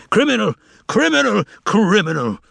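very high shout, cracking voice; cracked high shout. A narrator's reading. Pilate shouts for the garden to hear, cracked high yell. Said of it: "He raised his voice, cracked from giving commands, even higher".

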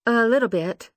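small, unsure reply; insecure young woman. Acted. Lucy voiced, playing insecure.

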